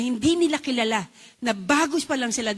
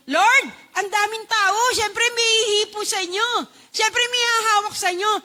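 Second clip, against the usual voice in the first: Peter protesting; 8 semitones higher than usual. Retold or imitated. Peter telling Jesus the crowd presses, exasperated and higher. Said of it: "ni Pedro".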